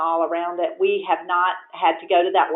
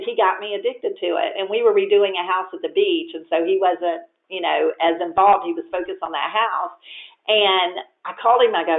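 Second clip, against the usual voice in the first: ordinary talk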